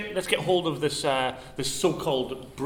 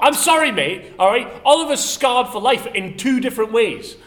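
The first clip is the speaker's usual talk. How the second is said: high, loud remonstrating; raised-voice sketch argument; mock indignation; 6 semitones higher, 8 dB louder than usual. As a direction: tell off the doctor in pretend outrage. Mock quarrel with the doctor.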